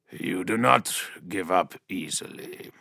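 deep filtered giant voice, amused; low machine growl. A narrator's reading. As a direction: growl it through a helmet vox. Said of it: "His voice was a low machine growl".

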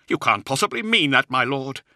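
high-pitched, disbelieving protest; numb protest. A narrator's reading. Officer shocked at his lord's accusation, protesting numbly. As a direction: protest as if stunned. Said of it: "he protested numbly".

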